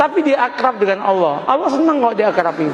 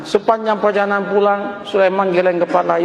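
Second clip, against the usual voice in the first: ordinary talk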